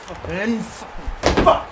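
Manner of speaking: a real, sharp shouted curse; loud curse. Real, not acted. Gamer cursing the goal he just conceded, loud shout. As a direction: spit out one loud swear.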